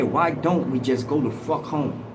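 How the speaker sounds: usual speaking voice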